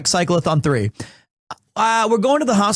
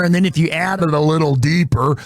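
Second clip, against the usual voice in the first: Tommy Lee Jones cowboy. Shown as a demonstration. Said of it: "I throw a little Tommy Lee Jones in there".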